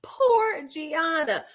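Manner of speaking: slow, half-crying mock lament; hammed-up weepy pity; mock wail. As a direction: drag it out like a fake sob.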